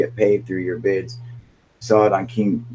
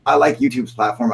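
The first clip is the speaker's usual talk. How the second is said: ordinary talk, nothing marked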